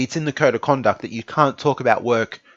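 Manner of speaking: ordinary talk, nothing marked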